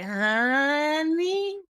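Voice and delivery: high, drawn-out sassy honey. Retold or imitated. Host warns someone who crossed a line, sassy. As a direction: tease with a pointed honey. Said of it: "I'm like".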